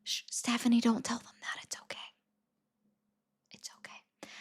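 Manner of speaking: hushed, barely audible whisper; whispered aside. Pretend. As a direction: shush someone in a secretive whisper.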